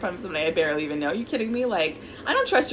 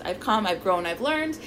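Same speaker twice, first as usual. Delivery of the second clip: ordinary talk, nothing marked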